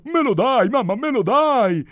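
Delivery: high, loud, whining simpleton; hammed-up silly son pestering; foolish first son. A narrator's reading. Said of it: "sette figli scemi".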